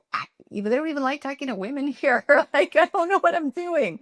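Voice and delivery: high, fast, flustered protest with a laugh; squeaky, rushed self-mockery; panicked younger self. Young manager pleading with her boss, high and flustered. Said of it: "And when I was like".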